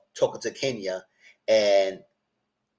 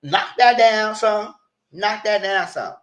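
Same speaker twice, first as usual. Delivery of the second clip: high, louder put-down; scolding; 10 semitones higher, 4 dB louder, slower than usual. Retold or imitated. To the castmate, a high scolding put-down. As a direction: tell her to tone it down. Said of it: "I said".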